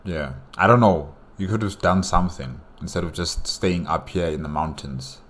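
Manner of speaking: normal talking voice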